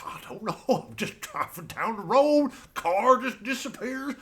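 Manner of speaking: ordinary delivery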